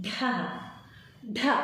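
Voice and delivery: ఢ pressed hard with a burst of breath; ఢ ఒత్తి పలకడం; aspirated dha. Shown as a demonstration. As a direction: lean on ఢ, breathy and forceful. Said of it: "ఎప్పుడైతే ఒత్తు వచ్చిందో దీన్ని".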